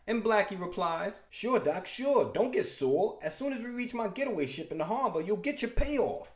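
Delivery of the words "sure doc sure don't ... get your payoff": cocky mobster brushing off the boss; wise guy attitude. Acted. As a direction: shrug it off like a smug gangster. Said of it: "showing a wise guy attitude".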